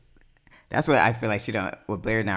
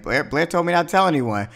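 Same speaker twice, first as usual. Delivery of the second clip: pitched-up imitation of an excuse; retold defensive excuse; 6 semitones higher than usual. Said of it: "she was like".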